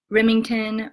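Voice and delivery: normal talking voice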